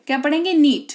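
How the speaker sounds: ordinary delivery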